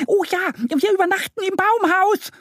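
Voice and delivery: very high, squeaky little duck cheering; excited, delighted chatter. A narrator's reading. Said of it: "flattert aufgeregt auf und ab". (aufgeregt schnatterndes Entchen)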